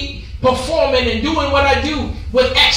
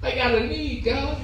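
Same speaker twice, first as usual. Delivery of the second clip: hushed, much softer plea to God; quiet plea; 8 dB quieter than usual. Acted. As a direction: drop almost to a murmur, pleading. Prayer cry said two ways.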